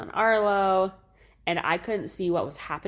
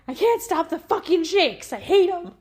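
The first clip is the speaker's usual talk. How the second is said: much higher, louder furious rant; mad inner rant; 14 semitones higher, 6 dB louder than usual. Retold or imitated. Mother recalling her panic after birth, high furious rant. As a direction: vent furiously at your own shaking body. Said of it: "I was so mad in that moment".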